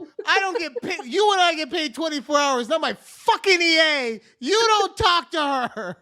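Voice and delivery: high, fast, hammed-up yelling; mock angry rant. Boss defending his assistant to a CEO, mock outrage. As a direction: rip into him as a comic outraged boss.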